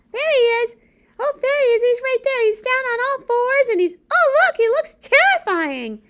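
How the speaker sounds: high, loud, eager puppet voice with a gasp; excited puppet. Acted.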